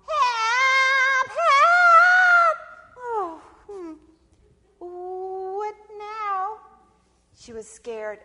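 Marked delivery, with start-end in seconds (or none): 0.1-2.6 very loud, high, stretched howl; screaming for help. Acted. Snake stuck in the hole, screaming for help. 3.0-6.6 high, drawn-out wondering voice; Sister Possum. Acted. Possum hearing cries again, high uneasy wondering.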